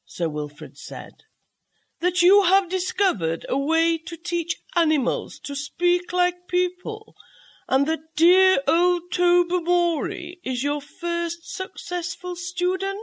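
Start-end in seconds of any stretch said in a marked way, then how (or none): 2.0-13.0 lightly voiced gentleman, pitched higher; a hint of a curious older gentleman, raised pitch; polite guest asking in a raised, lighter voice; Sir Wilfrid's voice. A narrator's reading.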